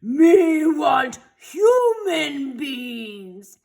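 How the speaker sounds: very slow, loud monster shout; monster demand. A narrator's reading. The monster to the town, a slow loud shout. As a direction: drag every word out like a hungry beast.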